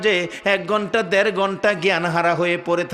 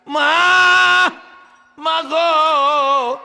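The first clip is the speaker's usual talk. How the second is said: पुकार: tag; 9 semitones higher, 8 dB louder, much slower than usual. Acted. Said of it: "আর ডাকে".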